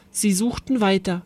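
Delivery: ordinary delivery, nothing marked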